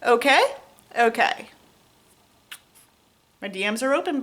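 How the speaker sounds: ordinary talk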